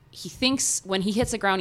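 usual speaking voice

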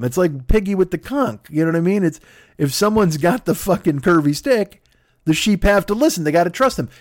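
ordinary delivery

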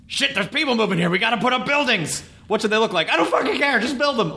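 high, harried mock urgency; panicked seventies developer. Host voicing a seventies Valley builder, high mock panic. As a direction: blurt it out high, frantic and careless. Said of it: "someone was like".

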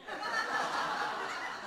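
high, soft burst of laughter. Real, not acted. Storyteller laughing high at his own story. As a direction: laugh high and light at the memory.

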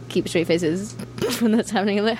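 ordinary talk, nothing marked

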